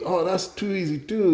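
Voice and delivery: high-pitched Cajun joke voice; Justin Wilson. Acted. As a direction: boast in a high Cajun accent. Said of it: "do the Justin Wilson thing and tell a joke".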